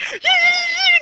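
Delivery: high-pitched imitated fangirl squeal; loud, very high mock scream of hysterical girls; screaming crying fans. Teacher imitating Beatles fans on TV, screaming and crying. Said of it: "they're screaming and crying in the audience".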